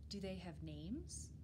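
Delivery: ordinary delivery, nothing marked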